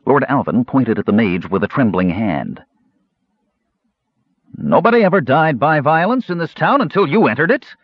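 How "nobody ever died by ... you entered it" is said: high, agitated accusing outburst; heated accusation. A narrator's reading. Lord Alvin pointing at the mage, high heated accusation. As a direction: accuse the mage, pitch well raised.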